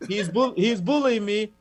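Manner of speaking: mock complaint. Host complains to guest about teasing.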